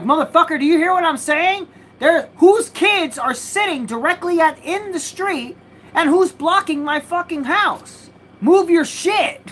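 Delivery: yelling at the neighbor blocking the house, furious; retold furious yell. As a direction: re-enact screaming at the neighbor to move his cars.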